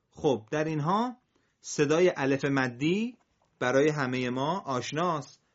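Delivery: usual speaking voice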